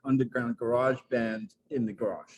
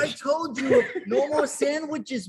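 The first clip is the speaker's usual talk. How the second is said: much higher, louder, hammed-up New York mom; mock New York accent; 13 semitones higher, 8 dB louder than usual. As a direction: scold like an exasperated New York mother. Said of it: "we can do accents".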